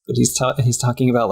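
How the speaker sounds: ordinary talk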